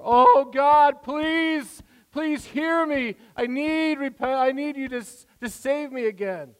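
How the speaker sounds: loud, high, pleading wail to God; mock desperate begging. Mocking someone who pounds on God's door, loudly begging. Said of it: "pounding on the door".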